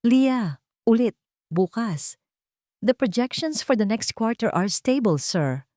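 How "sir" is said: ordinary delivery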